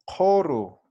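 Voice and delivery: "Koru" with back k and first vowel opened to o; careful model pronunciation. Shown as a demonstration. Teacher showing students the Q H word, "Koru" pronounced carefully. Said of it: "voy a pronunciar más o menos así".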